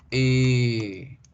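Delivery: "E" held long as one steady sound; lengthened vowel. Shown as a demonstration. Teacher showing vowel before R, "E" drawn out. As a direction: stretch "E" right out. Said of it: "el hecho de alargar la vocal".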